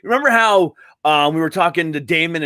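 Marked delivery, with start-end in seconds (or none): none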